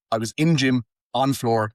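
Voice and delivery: "in gym, on floor" with no "the" before the nouns; dropped article. Shown as a demonstration. Showing friends how Yorkshire people talk, article dropped. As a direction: say "gym" and "floor" straight after "in" and "on". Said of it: "they, like, skip the duh".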